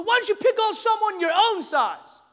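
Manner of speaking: high-pitched indignant challenge; retold challenge. Homeschooled kid challenges two bullies, high-pitched indignation.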